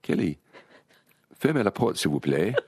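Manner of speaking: French command with the pitch lifted mid-phrase; amateur French accent, politely instructing; best French accent. Retold or imitated. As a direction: put on your best French for the dog. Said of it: "I said in my best accent".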